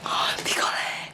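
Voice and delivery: slow, breathy unvoiced sigh of fatigue; tired whisper. Real, not acted. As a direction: let it out wearily under the breath. Tired sigh alone in room.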